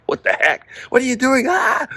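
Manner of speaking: high-pitched panicked yelp at the bloody floor; freaked-out shriek. Retold or imitated. Startled husband shrieking at the cat's gift on the floor. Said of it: "I'm, like, freaking out".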